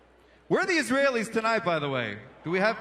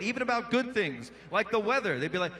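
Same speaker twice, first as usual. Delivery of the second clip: usual speaking voice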